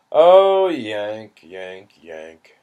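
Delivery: a cry of pity dying down; fading lament. Acted. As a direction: mourn over him, trailing away.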